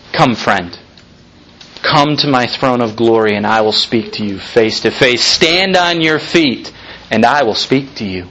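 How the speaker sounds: gentle low invitation. Acted. Preacher voicing Jesus calling a friend, low and gentle.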